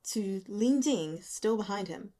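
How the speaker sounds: usual speaking voice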